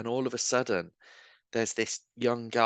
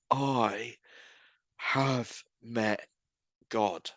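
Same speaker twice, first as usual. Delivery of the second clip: word by word with long pauses; halting words; 3 dB quieter, much slower than usual. Retold or imitated. Dazed man with little English after prayer encounter, halting words. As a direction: imitate someone struggling for each English word. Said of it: "he's not able to speak, like, many words of English".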